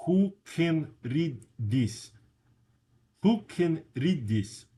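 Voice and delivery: thick Russian accent, slow and emphatic. Shown as a demonstration. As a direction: say English the way a Russian learner would. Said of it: "the way that a Russian would".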